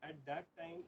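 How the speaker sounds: very faint, distant lecture voice; lecturing far from the microphone; barely audible. Real, not acted. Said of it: "your voice is very slow".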